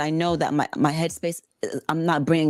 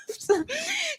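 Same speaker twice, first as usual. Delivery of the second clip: high laughing wail; 4 dB quieter than usual. Real, not acted. Laugh-cry after confession.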